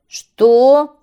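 loud outraged shout; kite shouting. Acted. Kite shouts at the birds in outrage, very loud. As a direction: bark it at them. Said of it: "крикнул змей".

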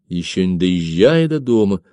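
plain reading voice

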